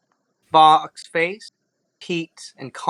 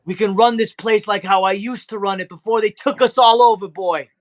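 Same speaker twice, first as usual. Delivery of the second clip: excited father; 8 semitones higher than usual. Acted. Father plans with his son.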